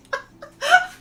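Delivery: squealing laugh of joy; high delighted laugh. Real, not acted. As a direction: burst into a high giggle. Friend joins stream.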